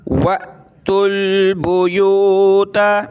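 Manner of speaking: the हमज़ा of वअतुल caught with a jolt, then बुयूता drawn out; glottal jolt. Shown as a demonstration. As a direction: clip the hamza sharply in वअतुल, slowly. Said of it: "यहाँ भी झटका देंगे". (वअतुल में हमज़ा पर झटका)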